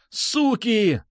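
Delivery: high-pitched drawn-out curse; shouting. A narrator's reading. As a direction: curse in a desperate cry. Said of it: "кричал Бубен". (яростный крик)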